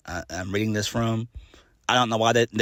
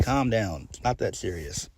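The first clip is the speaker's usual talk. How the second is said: usual speaking voice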